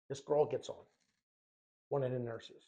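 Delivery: ordinary talk, nothing marked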